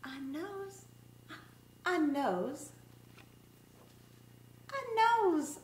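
lively, singsong picture-book read-aloud; reading to children. Acted. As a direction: read it like story time for little kids.

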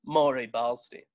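normal talking voice

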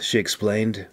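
plain reading voice

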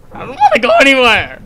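high, loud, fast protest; panicked wail. Real, not acted. Scared player refuses to move on, panicked wail. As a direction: wail it out in a rush.

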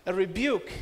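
usual speaking voice

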